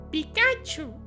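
high squeaky cartoon creature cry; Pikachu. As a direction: squeal it like a tiny electric mouse. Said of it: "Foi a minha melhor imitação".

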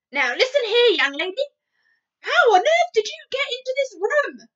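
loud, high furious scolding; furious shout. Acted. Maid furiously demanding how Sophie got in, loud high shout. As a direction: tear into the girl, full volume. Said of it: "the maid shouted furiously".

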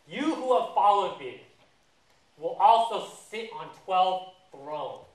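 acted-out declaration with weighty pauses; grand proclamation. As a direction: proclaim it as a solemn decree. Voices Jesus proclaiming to Peter.

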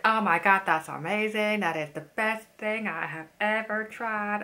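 loud, gushing imitation of the salesman's American accent; loud, hammed-up American salesman impression; mock American salesman accent. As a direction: rave about it like a pushy shop assistant. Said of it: "I'm just insulting him, this man, with my accent".

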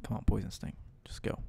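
whispered urging; whispered plea. Real, not acted. Gamer urging his attack to work, whispering. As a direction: whisper it like a prayer. Pleading with the game.

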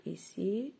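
ordinary talk, nothing marked

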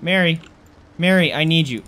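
calling out. Real, not acted. Gamer calling Mary for help, loud. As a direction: call her over in alarm.

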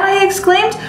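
ordinary delivery

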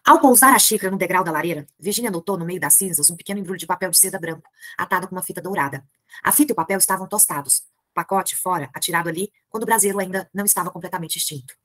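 reading a novel aloud to the class; measured literary narration; story reading. A narrator's reading. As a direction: read it like a storyteller. Teacher reads the novel aloud.

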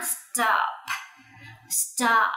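"Stop" in one falling syllable with a popped p; final p released. Shown as a demonstration. Teacher drilling children on "Stop", final p released. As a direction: pop the last sound of "Stop". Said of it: "bật âm cuối này lên".

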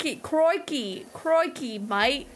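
repeated broad Australian catchphrase to warm up; Aussie accent. Pretend. Streamer prompted to resume, hammed-up Aussie. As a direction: hunt for the Aussie accent again. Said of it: "Hey, where's that accent?".

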